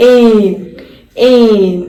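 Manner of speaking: loud, clear teacher demo of ain; sound of ain. Shown as a demonstration. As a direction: model the sound എയിൻ firmly for kids. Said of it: "ഇത് എയിൻ എന്നാണ് read ചെയ്യുന്നതെന്ന്".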